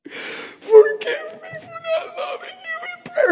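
very high, slow imitated sobbing plea; anguished repentance. Wife begging husband's forgiveness, imitated anguished crying. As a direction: beg through drawn-out, high-pitched crying. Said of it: "it was like it was eating her up".

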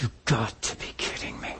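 retold silent disbelief, almost whispered; inner voice. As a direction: say it under your breath, as a private thought. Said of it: "inside I was like".

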